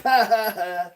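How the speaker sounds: high mock laughing; spelled-out imitation laugh; imitated laugh. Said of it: "he's laughing. Twin's like".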